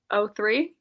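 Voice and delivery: ordinary talk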